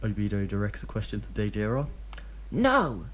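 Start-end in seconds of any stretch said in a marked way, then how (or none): 2.5-3.0 put-on high, louder bouncy little boy's no; excited child. A narrator's reading. Child Deidara refusing the kids menu, high excited shout. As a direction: shout it like an eager kid. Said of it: "Deidara bounces in his seat".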